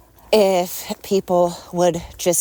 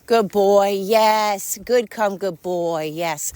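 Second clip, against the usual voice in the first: praising the dog; much slower than usual. Real, not acted. Owner praising her dog for coming, cheerful pet talk.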